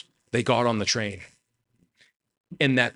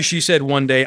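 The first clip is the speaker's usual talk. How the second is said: usual speaking voice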